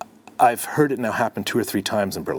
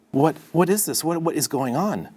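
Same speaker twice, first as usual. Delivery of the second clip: alarmed; 7 semitones higher than usual. Retold or imitated. Alarmed reaction in retold story.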